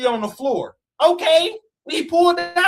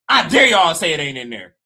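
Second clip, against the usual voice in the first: challenge; 6 semitones lower, 4 dB louder than usual. Real, not acted.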